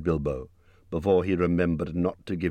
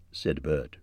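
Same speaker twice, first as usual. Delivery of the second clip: ordinary delivery, nothing marked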